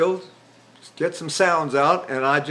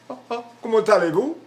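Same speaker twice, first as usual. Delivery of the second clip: placed foreign phrase; 5 semitones higher than usual. Shown as a demonstration. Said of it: "You can speak any language there".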